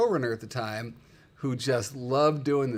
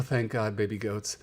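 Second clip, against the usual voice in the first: ordinary delivery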